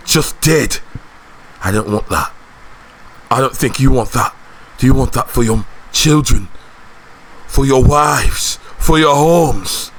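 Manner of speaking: rallying speech in short, weighty phrases; earnest war leader. Acted. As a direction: plead with them to join the fight.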